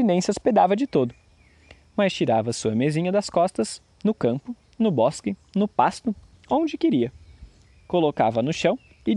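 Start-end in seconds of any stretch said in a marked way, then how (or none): none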